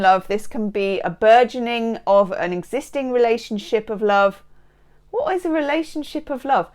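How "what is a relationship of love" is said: high, put-on questioning voice; mocking put-on voice. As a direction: query your own phrase in mock puzzlement. Mocks own odd phrase.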